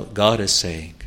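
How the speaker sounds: normal talking voice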